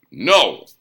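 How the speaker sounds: sharp loud bark of a no. A narrator's reading. Hans cutting off Valterian with a loud bark. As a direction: snap the no out hard and loud. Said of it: "barked Hans".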